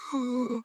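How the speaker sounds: sharp, soft gasp of shock; shared gasp. Acted. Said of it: "they all gasped together".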